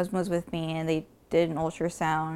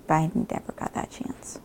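whispering; 3 semitones lower than usual. Real, not acted. Grieving sister recalls lost chance.

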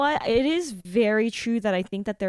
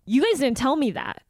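sharper mock complaint, not sweet; imitating pissed customer. Friend corrects how she told off the clerk, imitating her annoyance. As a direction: mimic your friend's annoyed protest. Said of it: "You did not say it that sweet".

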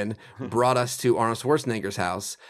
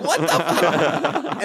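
very slow, drawn-out, laughing; stunned disbelief; 4 semitones higher, 5 dB louder, much slower than usual. Retold or imitated. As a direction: let it hang in shock. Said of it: "And we're like".

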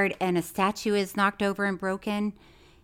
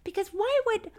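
mock furious; 3 semitones higher, 5 dB louder than usual. Real, not acted. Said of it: "I'm so furious".

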